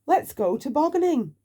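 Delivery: full of fun; keen on sledging; eager. Acted. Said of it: "said Mister Nonsense".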